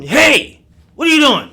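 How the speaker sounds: sudden high shout; calling out. Retold or imitated. Calling out to the stranger at the dumpster, loud shout. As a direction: yell across at the stranger.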